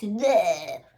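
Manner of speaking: loud disgusted bleh; hammed-up grumpy bleh; mock bleh.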